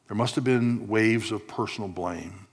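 ordinary talk, nothing marked

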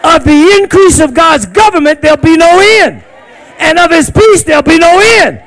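loud high preached shout; shouted sermon climax; full-force preaching. Shown as a demonstration. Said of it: "I said".

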